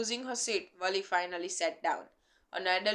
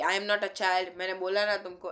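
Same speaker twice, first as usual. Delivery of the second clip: ordinary talk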